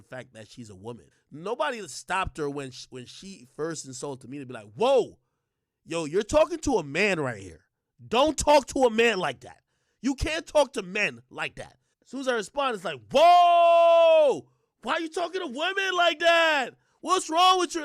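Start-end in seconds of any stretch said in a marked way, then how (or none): 4.7-11.8 loud, indignant acted intervention; mock rebuke. Bystanders stopping her insulting a man, mock rebuke. 13.1-17.8 loud, high-pitched cry of alarm; mock outraged shout. Onlookers stopping him as he responds, mock outrage.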